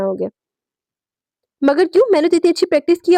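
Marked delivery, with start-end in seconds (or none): 1.6-3.1 high, fast indignant protest. A narrator's reading. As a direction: object hotly, rushing the words. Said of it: "और वह एहतजाजन बोली". (ऊँची, तेज़ आवाज़ में एतराज़)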